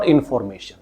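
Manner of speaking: information with a full FOR in the middle; wrong full-vowel pronunciation. Shown as a demonstration. Teacher showing students the incorrect information, full FOR. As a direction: say information as in-FOR-mation, FOR unreduced. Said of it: "कहीं से भी ठीक नहीं है".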